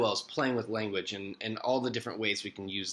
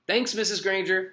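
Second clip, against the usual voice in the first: high-pitched breezy thanks; breezy schoolboy; 8 semitones higher than usual. A narrator's reading. Nick thanks his teacher on leaving, breezy schoolboy voice. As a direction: toss off thanks in a higher boy's voice.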